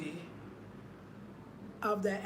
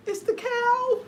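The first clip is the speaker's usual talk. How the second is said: high, loud horrified cry; shocked realization; 12 semitones higher, 3 dB louder, slower than usual. Real, not acted. Vlogger recognizing the cow in the gift, shocked. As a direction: blurt it out in disbelief.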